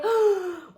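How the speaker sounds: high, horrified gasp; disgusted gasp. Retold or imitated. Storyteller recalling an unwanted kiss, disgusted gasp. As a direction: gasp as if recoiling from it.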